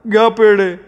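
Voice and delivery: high-pitched, abrupt offering; mild exasperation. A narrator's reading. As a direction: present it with a touch of annoyance. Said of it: "आदळत म्हणाला".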